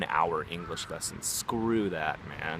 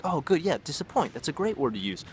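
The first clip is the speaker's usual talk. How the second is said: higher-pitched, upbeat praise; encouraging teacher; 8 semitones higher, 3 dB louder than usual. Acted. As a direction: cheer a student on before correcting. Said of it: "that's how I would correct somebody".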